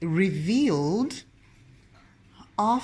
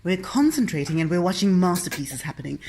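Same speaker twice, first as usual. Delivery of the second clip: ordinary talk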